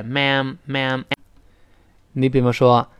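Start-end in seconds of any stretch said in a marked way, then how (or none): 0.0-1.0 ma'am, ma'am slowly with lips spread; slow ma'am with a wide ae. Shown as a demonstration. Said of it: "把嘴咧开".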